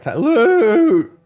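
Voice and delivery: squeaky cracked high yelp; voice cracking. Retold or imitated. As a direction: let the voice break up high. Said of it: "No, my voice broke".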